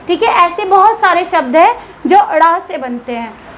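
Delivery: ordinary talk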